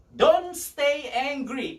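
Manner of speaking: DON'T drawn out high and loud, "Don't stay angry" very slow; emphatic refrain. Real, not acted. Preacher drilling the key point into the congregation, emphatic.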